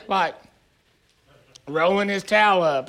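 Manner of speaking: usual speaking voice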